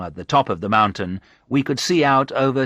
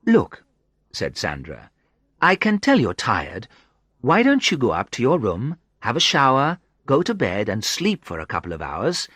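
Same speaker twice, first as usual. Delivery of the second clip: higher, playful woman's voice; lightly teasing woman; 7 semitones higher than usual. A narrator's reading. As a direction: needle him gently as her. Sandra's voice, said-tags excluded.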